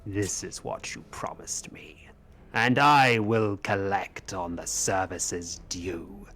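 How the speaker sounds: slow, deliberate royal threat; swell, drop to a hushed reminder, then swell; grave, ominous kingly demand; regal spectral king, menacing. Acted.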